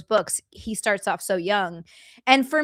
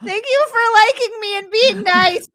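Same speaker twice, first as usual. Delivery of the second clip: squeaky overwhelmed thanks; high flustered gushing; 12 semitones higher, 9 dB louder than usual. Retold or imitated. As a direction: gush like a flustered kid. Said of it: "I'm just like".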